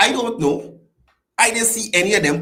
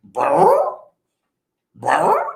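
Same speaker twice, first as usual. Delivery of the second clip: Scooby-Doo growl; 14 semitones higher, 6 dB louder than usual. Retold or imitated. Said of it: "Scooby-Doo just go".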